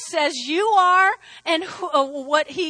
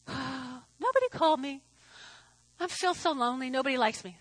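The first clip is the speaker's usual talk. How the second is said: mock self-pity; 4 semitones lower, 10 dB quieter than usual. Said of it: "That's baloney".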